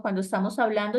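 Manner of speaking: ordinary talk, nothing marked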